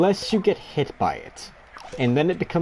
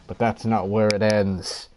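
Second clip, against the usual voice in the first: ordinary talk, nothing marked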